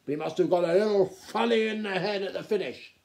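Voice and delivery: loud, high, indignant outburst; blustering, scornful aristocrat; snapping duke. A narrator's reading. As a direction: refuse to hear another word. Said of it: "snapped the Duke".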